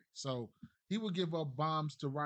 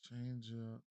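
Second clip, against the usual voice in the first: muttering to himself; 5 semitones lower, 13 dB quieter than usual. Real, not acted. Reading stats off screen.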